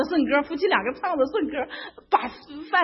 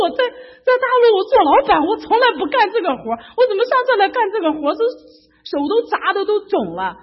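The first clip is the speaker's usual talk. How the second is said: high, loud exasperated grumble; loud complaint; 9 semitones higher, 8 dB louder than usual. Retold or imitated. Complaining that American dollars are hard to earn, loud exasperated grumble. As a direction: vent it loudly, comically fed up.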